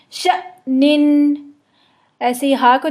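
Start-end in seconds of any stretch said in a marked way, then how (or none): 0.1-1.4 शनिन stopped with a jolt after शa, very slow; sukun hamza jolt. Shown as a demonstration. Teacher demonstrating silent hamza, slow with a jolt. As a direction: cut शनिन with a sudden throat stop. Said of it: "एक झटके के साथ हमज़ा पे रुक जाएगी".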